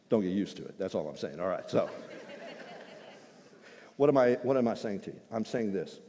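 normal talking voice

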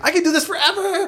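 high gleeful boast; excited. Retold or imitated. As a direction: crow it, high and giddy. Acting the runner's high.

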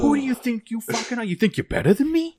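mock furious Boston guy yelling down the phone; over-the-top Boston accent rant; angry Boston accent. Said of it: "we all have horrible Boston accents".

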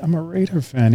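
answering the builder's Dallas jab; mock retort. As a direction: toss back a quiet comeback. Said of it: "I'm like".

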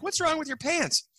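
very high-pitched startled question; imitating a baffled bartender; taken aback. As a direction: ask it high, thrown by the sight. Bartender reacts to torn pants.

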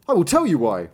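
high, loud storybook reply; emphatic master. A narrator's reading. Master answering the puzzled apprentice, emphatic.

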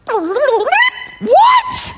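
very high, loud, incredulous What; mock shock. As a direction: shriek it in mock disbelief.

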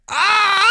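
loud high frustrated yell; exasperated cry. Acted. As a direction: let out a fed-up yell. Exasperated about youth slang.

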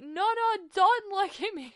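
high, loud panicked shriek. Retold or imitated. Panicked shout at Gavin, falling in mud.

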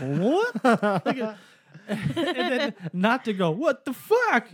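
high, slow, laughing disbelief; high, stretched mock outrage; shocked. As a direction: drag out the shock while laughing. Retold shock at finding the plastic.

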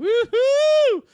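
whooping high and loud, slowly stretched out; a party cheer; woohoo. Pretend. Husband on spring break, cheering loudly.

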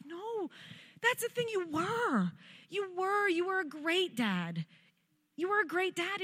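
high, soft, pleading insistence; tearful reassurance. Retold or imitated. Daughter reassuring her weeping dad, high and tearful.

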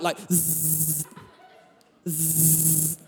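low electric zzz buzz like sparks; buzzing welding noise. Retold or imitated. Telling the church about the welding next door, buzzing zzz noise. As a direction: hum the crackle of a welder.

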